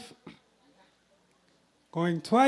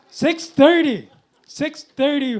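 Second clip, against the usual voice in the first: excited bid call; 5 semitones higher, 7 dB louder than usual. Real, not acted. New top bid called out.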